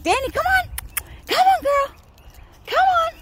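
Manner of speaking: high, loud call to a distant dog. Real, not acted. Calling dog from afar.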